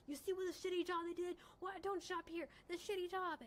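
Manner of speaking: a hint of an irate shopper; mock angry customer. Retelling a customer shouting at the crowd, mild mock rant. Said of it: "screaming and waving the suit".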